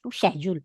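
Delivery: schedule opened with sh, not sk; British schedule. Retold or imitated. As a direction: say schedule as "shed-yool". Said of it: "Sabi niya".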